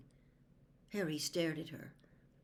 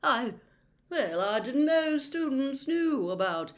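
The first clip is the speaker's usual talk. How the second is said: higher, slow, caught-out stammer; flustered seer; 8 semitones higher, 7 dB louder than usual. A narrator's reading. Trelawney caught out by Harry, flustered and evasive. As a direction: stall like a guilty prophetess.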